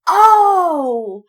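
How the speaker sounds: a loud, high startled "Oh!"; mock surprise. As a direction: jump at the sight of it. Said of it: "And I was like".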